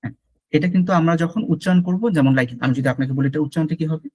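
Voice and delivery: normal talking voice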